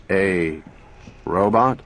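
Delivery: slow, toneless robot voice with no intonation; flat mechanical voice. A narrator's reading. Talking robot booming its answer to Gloria, mechanical and flat. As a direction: speak like a machine, every word level.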